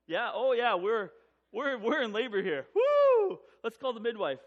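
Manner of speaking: loud, whooping excitement; excited realisation. Retold or imitated. Couple realising labour has truly started, loud and excited. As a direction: build to a high whoop, then rally to action.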